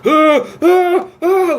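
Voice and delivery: loud, high climax gasps; mock climax moans. Retelling the hobo's fake-out in the theater, mock orgasmic moans. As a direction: imitate someone building to orgasm. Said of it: "like he's about to come".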